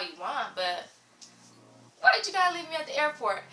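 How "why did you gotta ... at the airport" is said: higher, louder and fast, venting hurt frustration; exasperated outburst. Real, not acted. To the boyfriend who stood her up, a fast, higher, louder exasperated outburst. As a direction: rattle it off like a wounded rant.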